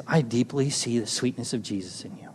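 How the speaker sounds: very soft, low, tender words; hushed, intimate blessing; retold tender blessing. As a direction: quote the old man in a hushed, low voice. Tender blessing quoted from old man.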